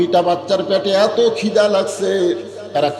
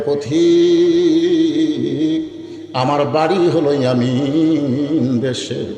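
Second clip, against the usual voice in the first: slow, long-held vowels; drawn-out plaintive reply; much slower than usual. Acted. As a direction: stretch each phrase ending like a slow lament. Woman's reply voiced in story.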